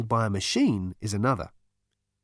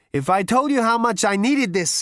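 higher, louder, greedy voice; hungry for that cake; ravenous Zaphod; 11 semitones higher, 5 dB louder than usual. A narrator's reading. Zaphod grabbing the fairy cake, louder ravenous voice. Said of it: "he said ravenously".

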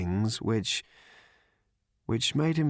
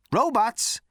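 sharp, louder question; 12 semitones higher, 4 dB louder than usual. Acted. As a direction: snap the question. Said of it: "said Zaphod sharply".

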